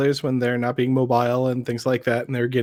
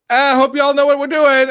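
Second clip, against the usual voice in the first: mock reckless tank, pitched up and louder; cocky tank; 11 semitones higher, 6 dB louder than usual. Imitating a careless tank before he dives into the fight. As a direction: toss it off like a tank charging in blind.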